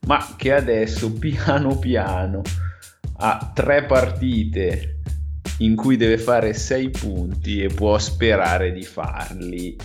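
ordinary delivery

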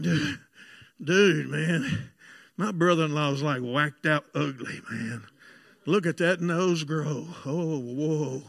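low, slow, spaced-out mumbling to himself; smoked-up stoner. Retold or imitated. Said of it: "I'd be smoked up".

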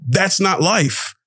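ordinary talk, nothing marked

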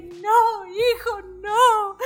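high, wailing mother's plea, imitated; mock sobbing mother. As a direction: ham up a crying mom begging her son. La mamá llorando suplica al hijo. (madre llorando, imitada y exagerada)

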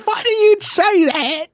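high, slow mock posh English; mock posh British accent replying. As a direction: put on a prim English voice.

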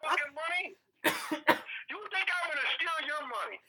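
high, laughing mock outrage; mock Joe Pesci rant. Telling friends how Pesci snapped, mock outraged. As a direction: laugh through an imitated tough-guy rant.

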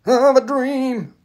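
ringing mock oratory with long-held vowels; MLK impression. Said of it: "M L K".

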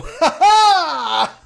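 high-pitched, helpless cackle of joy; delighted outburst laugh. Retold or imitated. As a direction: burst out in a high laugh, reliving the thrill. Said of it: "and I just go".

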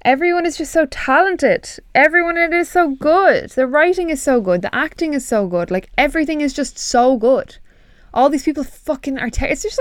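high, swooping, emphatic praise; effusive gushing. Real, not acted. Praising a beloved TV show, effusive and excited. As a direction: gush with each praise soaring up then falling.

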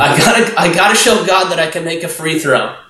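eager to prove. Retold or imitated. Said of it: "so often we're like".

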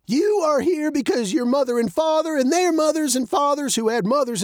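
high-pitched building spiel; old man, impassioned. Acted. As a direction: pile up the list like a practised speech.

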